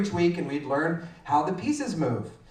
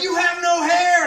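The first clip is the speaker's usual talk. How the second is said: high, loud imitation of kids exclaiming; shocked kids; 11 semitones higher, 6 dB louder than usual. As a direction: blurt it out like an astonished child. Class exclaims at bald teacher.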